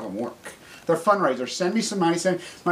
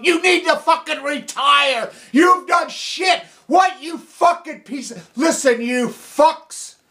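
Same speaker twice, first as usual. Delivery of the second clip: furious rant; 3 semitones higher than usual. Real, not acted. Telling critics to sit down, furious shouting. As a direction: tell them to retire, yelling.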